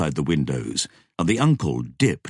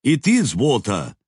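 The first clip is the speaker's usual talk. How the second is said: called down; 6 semitones higher, 4 dB louder than usual. A narrator's reading. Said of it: "Dev called down".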